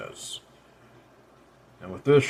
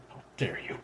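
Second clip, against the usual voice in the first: snarling; 5 semitones lower, 13 dB quieter than usual. A narrator's reading. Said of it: "she snarls".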